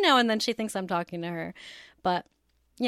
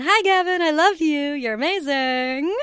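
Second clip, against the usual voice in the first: high, loud, gushing hello; gushing shout-out; 7 semitones higher, 7 dB louder than usual. Real, not acted. An aunt greets her nephew Gavin on air, gushing shout-out. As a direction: call out adoringly, stretching out the ending.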